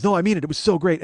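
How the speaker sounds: loud, high insisting; insistent enthusiasm. Retold or imitated. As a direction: override the shush and insist. Guy insisting despite wife's shush.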